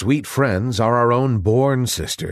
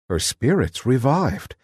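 ordinary delivery, nothing marked

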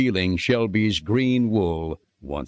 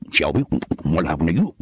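quick, lightly voiced pig; P J asks Shelby what happened, quick and puzzled; P J Pig questioning; 4 semitones lower, faster than usual. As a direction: ask it fast as the pig friend. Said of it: "P J questioned".

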